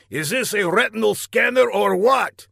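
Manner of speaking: high, rising irritated question; exasperated villain. A narrator's reading. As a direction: demand an answer from the bungling underling. Villain demands an answer.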